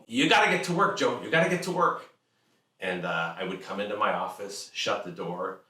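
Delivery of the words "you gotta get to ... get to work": higher, louder insistent dad; pushy father. Retold or imitated. Father insisting son go to work, high loud insistence. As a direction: push him out the door to work.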